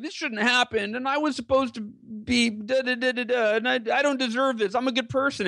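high, whiny self-pitying voice, slowing on da da da; mock victim whine. Hal mocks himself moping in a wheelchair, high whiny voice. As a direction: play the sulking victim, half mocking. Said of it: "this victim mentality".

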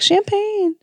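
high, hushed, posh-sounding champagne; high mock-fancy champagne voice. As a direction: announce it like a fancy toast. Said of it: "so I'm like".

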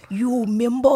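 a hint of a mafia tough guy; lightly voiced mobster berry. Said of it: "I can even do his voice".

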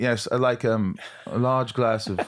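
mock English accent on a date. Host imagining the actor ordering on a date, English accent. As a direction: put on an English accent, hesitating mid-order. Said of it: "Did you find yourself on dates going".

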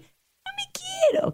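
high, slow, whiny mock lament; mock self-pity. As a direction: whine it like a self-pitying victim. Mocking self-pitying person.

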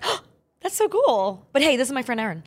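high, pleased little gush; flattered reply. Retold or imitated. Actress to admiring young star, flattered high gush. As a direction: pitch it up, politely delighted.

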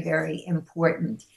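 ordinary talk, nothing marked

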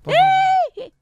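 very high, loud please; high pleading shout. Real, not acted. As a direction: plead with a shrill cry. Pleading with the cohost.

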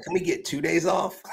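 mock whiny manager. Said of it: "Dusty's like".

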